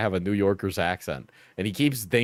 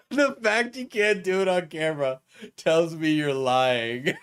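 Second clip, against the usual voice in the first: higher voice, laughing through the words; 5 semitones higher than usual. Real, not acted. Streamer amused by a viewer's accusation, laughing. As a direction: read it out while cracking up.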